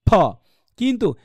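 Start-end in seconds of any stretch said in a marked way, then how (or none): 0.1-0.1 "ফ" as a bare aspirated P; sound demo. Shown as a demonstration. Teacher shows the IPA character's sound, pronunciation demo. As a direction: puff out a single "ফ". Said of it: "এটার উচ্চারণ হবে গিয়ে কি".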